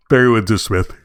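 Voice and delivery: talking through a held-back yawn; words stretched by a suppressed yawn; stifled yawn. Real, not acted. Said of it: "Excuse me. Suppressing a yawn there".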